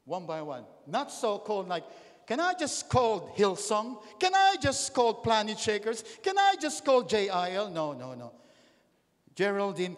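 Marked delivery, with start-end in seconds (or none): 2.3-7.7 mock appeal. Imagined believer asking to call churches on judgment day, mock appeal. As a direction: plead loudly, stretching the question out.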